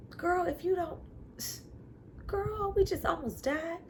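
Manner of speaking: mock the near-death panic; imitating Tick. Tick voiced after the scream.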